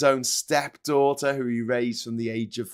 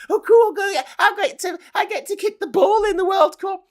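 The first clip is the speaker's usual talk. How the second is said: high, mocking rich-kid voice; mock a spoiled brat; 5 dB louder than usual.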